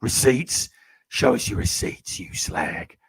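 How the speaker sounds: hoarse, voiceless growl of a soap character; Frank Butcher. Acted. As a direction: rasp it out in a slow, whispery impression. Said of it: "do your Frank Butcher voice".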